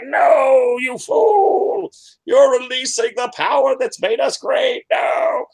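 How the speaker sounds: shrieking high furious ghost yell. Acted. GM voicing Edlin's ghost at the party, shrieking in fury.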